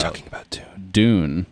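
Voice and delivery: whisper. Real, not acted. Whispered aside about Dune.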